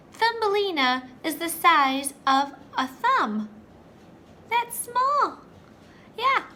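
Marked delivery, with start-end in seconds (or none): 4.5-6.4 high squeaky marvel. Acted. Teacher marvelling at tiny girl.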